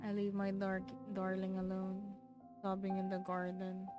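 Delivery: normal talking voice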